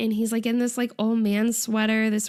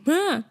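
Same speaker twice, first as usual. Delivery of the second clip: short, high-pitched startled "ah"; mild scared yell; 7 semitones higher than usual. Retold or imitated. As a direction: imitate a little yelp of fright. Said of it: "he gets a little scared and yells".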